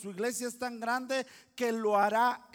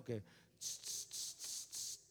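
catcall hissing; 9 semitones lower, 16 dB quieter than usual. Retold or imitated. Imitating men catcalling women.

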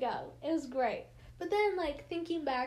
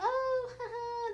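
nervous fake laugh; 10 semitones higher, slower than usual. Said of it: "So we're like".

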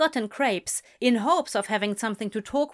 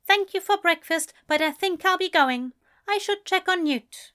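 higher, louder cold reply; Aziraphale's voice; 9 semitones higher, 3 dB louder than usual. A narrator's reading. Aziraphale snubbed by Crowley, higher and cold. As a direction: gone frosty and offended.

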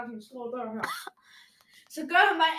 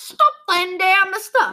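put-on much higher, much louder British-accented Danish bark; mock British teacher; 7 semitones higher, 11 dB louder, slower than usual. Classmates parroting the British teacher, loud mock accent. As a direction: ham up the foreign teacher's order. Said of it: "de siger sådan".

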